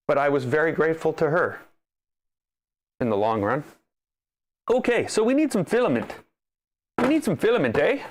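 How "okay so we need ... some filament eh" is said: higher, lilting Canadian with a tag "eh"; jokey Canadian sing-song; Canadian accent. Acted. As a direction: play up the Canadian twang. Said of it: "Sound all Canadian".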